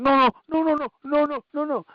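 very high, pleading no no no; mock pleading. Retelling the victim in the video, in a high mock plea. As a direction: beg for mercy in a high squeal.

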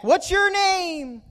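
high, slow echoed call; stretched-out high holler in reply; echo yelling back. Retold or imitated. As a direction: copy the boy's shout like an echo. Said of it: "they s-, yelled back".

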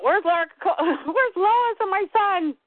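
high, slow, drawn-out worried whine; mock a fretting Superman; whiny Superman. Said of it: "all he worries about".

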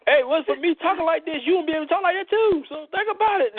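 very high mock comeback; put-on sassy character. Host playfully talking back, high put-on sassy voice. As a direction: argue back in a high, hammed-up voice.

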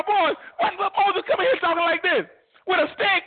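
high laughing scorn; mocking Pharaoh. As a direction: jeer to his boys at the stick.

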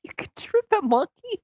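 high-pitched disbelieving query; incredulous question. Real, not acted. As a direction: squeak it in total disbelief. Disbelieves co-host's monkey boast.

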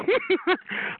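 short high giggle, a bit soft; taunting laugh. Real, not acted. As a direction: giggle at the caller's strained throat. Taunting laugh during phone argument.

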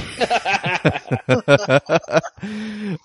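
high breathy laugh; breathless laugh. Real, not acted. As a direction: laugh while panting. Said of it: "as I catch my breath".

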